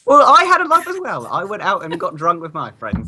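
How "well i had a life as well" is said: high mocking voice. Retort to the friend.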